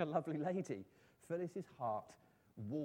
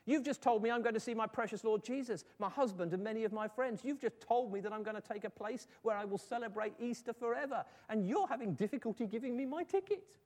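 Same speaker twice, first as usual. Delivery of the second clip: higher-pitched, elated exclamation; joyful protest; 7 semitones higher, 5 dB louder than usual. Acted. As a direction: protest with delighted conviction, pitched up. Dying Edith cheerfully reproaches her doctor.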